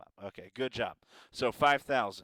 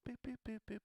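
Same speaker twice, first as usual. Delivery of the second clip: high boop noises; 10 semitones higher, 11 dB quieter, much faster than usual. Pretend. Break jingle noises.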